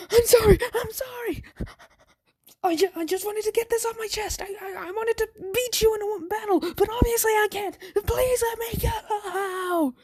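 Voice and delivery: high, frightened, tearful begging; panicked high-pitched crying plea; terrified pleading. Acted. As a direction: beg for mercy, voice cracking high. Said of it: "Bakugo's literally peeing his pants".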